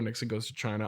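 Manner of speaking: usual speaking voice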